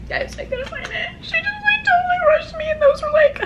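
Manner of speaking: high, squeaky half-sob half-laugh; mock despair, high and breaking; laugh-crying. Real, not acted. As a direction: whine it out through laughter. Laugh-crying about lost nail.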